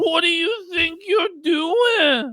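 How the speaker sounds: high, drawn-out mock outrage; imitated high, slow wail of objection; mock protest. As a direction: whine it slowly as a hammed-up victim. Voicing the licked man's protest.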